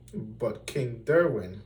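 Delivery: usual speaking voice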